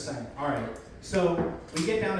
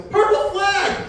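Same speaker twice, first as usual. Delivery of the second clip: high, drawn-out loud yell; bellowed alert from the shore; screamed warning; 9 dB louder, slower than usual. Acted. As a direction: shout it slowly across the waves. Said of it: "they are screaming something".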